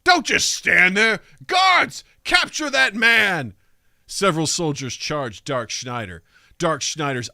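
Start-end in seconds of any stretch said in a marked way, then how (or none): 0.1-3.6 high, loud, slow command; shouted order. Acted. Minister ordering guards to seize him, loud slow command.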